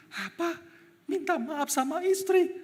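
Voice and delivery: high, mock-outraged scoff; scoffing ego, pitched high; incredulous ego. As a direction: play the proud husband balking at apologizing. Preacher voices his own ego reacting.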